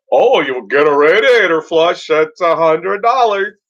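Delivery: oily salesman selling extras; hammed-up upsell salesman; pushy salesman. Mocking oil change salesman.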